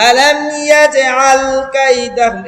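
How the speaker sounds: slow melodic Quranic chant; Quran recitation. Shown as a demonstration. Teacher reciting the verse to students, melodic chant.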